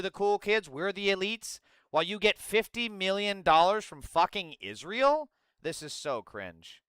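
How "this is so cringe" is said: cringing mutter. Real, not acted. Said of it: "reacting to clip".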